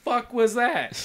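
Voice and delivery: high, drawn-out acted bewilderment; baffled disbelief. Character reacting to the brother running away, baffled. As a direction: stretch each word out, incredulous.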